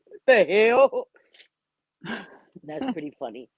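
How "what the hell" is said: laughing. Real, not acted. Laughing at the saying.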